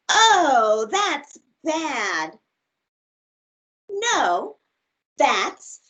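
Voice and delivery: lively, expressive storybook read; reading to children. Acted. Teacher reads a picture book aloud to her class, bright and expressive. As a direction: read it big and playful for little listeners.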